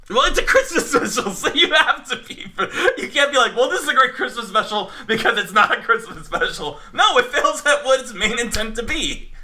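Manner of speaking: high, loud, exasperated objection; heated, laughing protest. Real, not acted. Host disputing co-host's defence of the special, worked up and high-pitched. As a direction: argue back incredulously through laughter.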